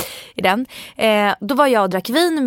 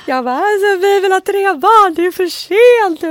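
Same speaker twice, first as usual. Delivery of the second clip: put-on loud, high, wailing drunk sob-talk; high loud tipsy wailing, the voice cracking into tears; drunk crying; 11 semitones higher, 7 dB louder than usual. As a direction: wail it like a tipsy crying fit. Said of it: "ett så här fyllegråt".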